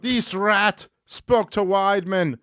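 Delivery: slow, high-pitched Irish attempt; bad Irish accent. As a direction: badly fake an Irish accent, drawn out. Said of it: "No, it's bad".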